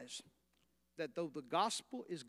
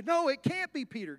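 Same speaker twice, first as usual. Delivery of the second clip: higher, louder protest of disbelief; disbelieving church; 8 semitones higher, 6 dB louder than usual. Retold or imitated. Church members doubting the girl, higher mock disbelief. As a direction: refuse to believe it, half laughing. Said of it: "And they say".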